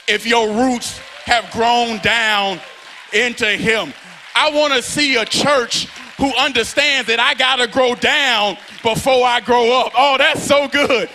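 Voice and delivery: loud, rhythmic, near-sung preaching cadence; full-force preaching. Acted. Preacher building the climax with the congregation, loud and sung. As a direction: whoop it up for the church.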